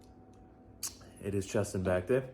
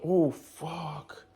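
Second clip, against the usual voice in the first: dismayed swearing; 8 semitones higher than usual. Real, not acted. Vlogger finding the shaker cup was open, dismayed. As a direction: catch a mishap and swear in dismay.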